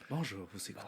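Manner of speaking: soft, sultry announcer voice; voix suave. Pretend. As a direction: purr the greeting low and soft. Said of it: "Ça devrait dire avec une petite voix suave". (petite voix suave d'animateur)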